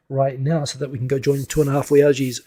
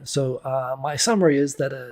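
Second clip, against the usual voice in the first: ordinary talk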